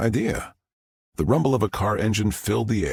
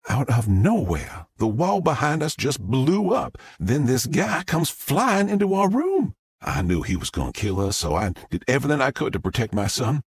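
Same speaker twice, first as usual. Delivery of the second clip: a Southern mom gushing to the press; Southern witness; 6 semitones higher than usual. Acted. Southern witness tells story.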